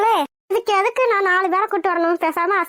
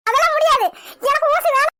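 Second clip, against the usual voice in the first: higher, agitated protest; panicky refusal; 4 semitones higher than usual. Acted. Patient refusing to hold out her arm, panicky. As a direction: dig in, frightened of the needle.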